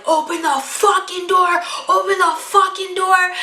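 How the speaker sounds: furious demand, shouted and repeated; enraged shouting. Retold or imitated. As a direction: yell it like you're trying to rip the door open. Enraged man trying to open door.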